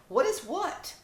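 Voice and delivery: high, puzzled little dog; puppy voice. Acted. Izzy the puppy asking Zoe back. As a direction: lift it up high, confused.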